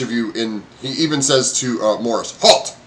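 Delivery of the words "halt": loud, higher barked halt; shouted halt. Acted. Goblin guard halts each traveller with a loud bark. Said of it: "he goes".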